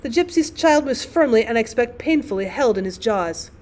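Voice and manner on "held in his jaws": ordinary delivery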